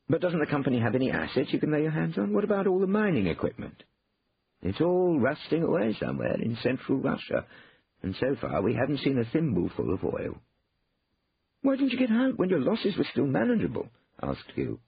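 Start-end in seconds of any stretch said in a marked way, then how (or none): 0.1-3.8 higher, questioning brother. A narrator's reading. Brother asks after company assets, anxious questioning. 4.6-10.4 lightly voiced, higher-pitched brother; mildly resigned explanation. A narrator's reading. Brother reports the equipment lost in Russia, mildly resigned. 11.6-13.9 much higher questioning voice; high-pitched brotherly reproach. A narrator's reading. Brother asks why he didn't get out, high exasperation.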